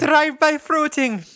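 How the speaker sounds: put-on high, loud, drawn-out muddled Russian accent; hammed-up vaguely Scottish-Russian accent; kinda Russian accent. Storyteller quoting to cohost, uncertain Russian accent. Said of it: "I don't really know what that accent was".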